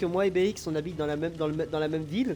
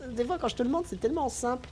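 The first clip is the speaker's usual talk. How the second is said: amused high voice; 12 semitones higher than usual. Real, not acted. Amused after laughing.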